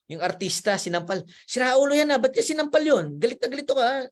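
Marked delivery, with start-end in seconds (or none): 1.5-4.0 high, louder indignant outburst; mock outraged viewer. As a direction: play the viewer fuming at the slap. Viewer angry at slap on TV. (galit na galit na manonood, mataas ang boses)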